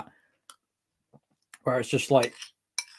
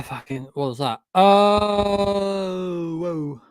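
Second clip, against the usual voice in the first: usual speaking voice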